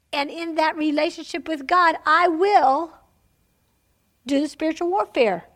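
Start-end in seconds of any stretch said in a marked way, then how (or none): none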